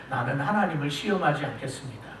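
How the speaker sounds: preacher imitates Ahaz evading, softly; sly dodge. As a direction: slide past the question quietly. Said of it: "아주 슬쩍 비껴가는 거예요".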